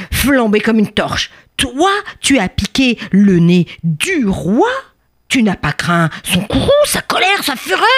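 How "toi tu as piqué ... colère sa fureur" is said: roaring anger. Acted. King scolding the bee for stinging him, roaring anger. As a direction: stress each offence, climbing higher in fury. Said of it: "Le roi rugit de colère".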